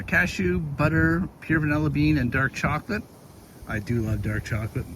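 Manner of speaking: ordinary talk, nothing marked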